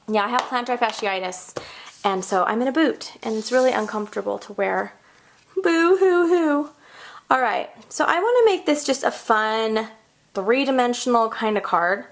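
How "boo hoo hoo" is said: slow, high, loud fake crying; mock sobbing "boo hoo hoo". Crafter mock-crying to viewers about her uncomfortable boot. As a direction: ham up self-pity over the boot.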